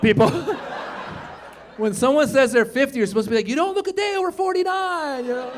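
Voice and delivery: normal talking voice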